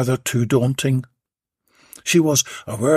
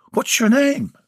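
woman voice; 12 semitones higher than usual. A narrator's reading. Belinda voiced in dialogue.

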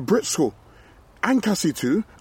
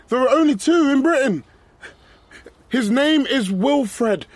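high, loud shouts into the dark; calling out loud; 10 semitones higher, 5 dB louder than usual. Acted. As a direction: yell it across the field. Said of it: "could you call them again".

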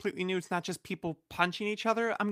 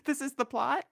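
rising disbelief; incredulous echo; 7 semitones higher than usual. Real, not acted. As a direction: repeat it as a shocked question.

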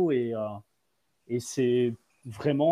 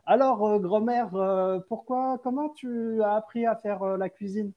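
lightly voiced, higher curious interviewer; a hint of a chirpy interviewer; 6 semitones higher than usual. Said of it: "genre, ça fait".

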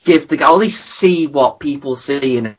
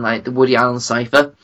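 ordinary delivery

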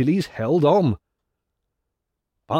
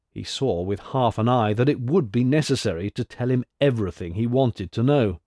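ordinary delivery, nothing marked

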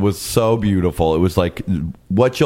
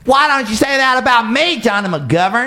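mock diva jab. Playful mock insult banter.

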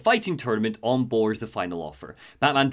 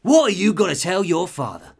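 louder, higher Cockney villain; Cockney Penguin; 5 semitones higher, 5 dB louder than usual. Acted. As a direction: menace him in East End Cockney. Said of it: "Cockney, Cockney".

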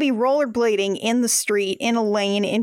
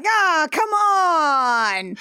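higher, long drawn-out driver's gripe; hammed-up road-rage holler; mock New York yelling; 5 semitones higher, much slower than usual. New York drivers after a smash, mock yelling. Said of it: "screaming at each other".

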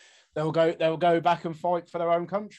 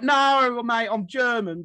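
high, loud mock London reply; London accent; 7 semitones higher, 5 dB louder, slower than usual. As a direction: play the cheery London-accented German. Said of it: "heard this voice in a London accent".

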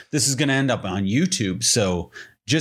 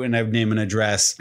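ordinary delivery

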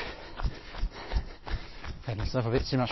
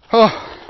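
loud strained gasp; 8 semitones higher, 11 dB louder than usual. Real, not acted. Gasp at end of sprint.

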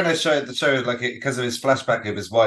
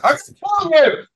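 slow, much higher and louder, hammed-up menace; villain threat; 8 semitones higher, 6 dB louder, much slower than usual. Friend imitating the terrorist's knife attack, hammed-up menace. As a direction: stretch the threat out like a cartoon villain. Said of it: "like, he goes".

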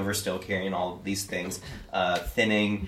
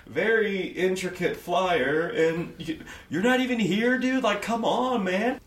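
aggravated; 11 semitones higher than usual. Acted. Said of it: "is aggravatedly being like".

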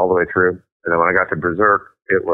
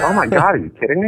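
mock disbelief; 9 semitones higher, faster than usual. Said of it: "And it's like".

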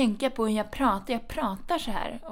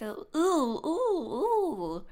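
mock sob; 9 semitones higher, much slower than usual. Mock sob about dialect teasing.

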